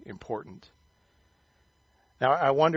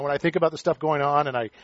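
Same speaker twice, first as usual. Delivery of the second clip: normal talking voice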